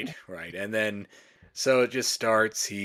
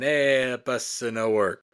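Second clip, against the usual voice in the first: mock broken English; 4 semitones higher, slower than usual. Innkeeper's helper telling tourists the bus is broken, mock Italian accent. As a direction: announce it in clumsy mock Italian. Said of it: "Horatio Sanz just walks in".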